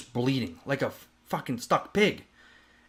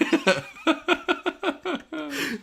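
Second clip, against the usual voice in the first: high laugh. Real, not acted. Laughs at his own retelling.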